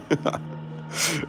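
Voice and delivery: high breathless wheezing laugh; helpless airy giggle at the price drop; real laugh, high and breathy; laughing till crying. Real, not acted. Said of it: "and I'm crying".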